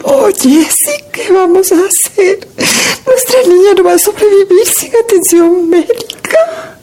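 crying. Acted. Mother pleads for the baby.

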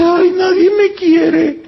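high, slow, whimpering mock lament; whiny self-pity. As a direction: whine like someone who feels unloved.

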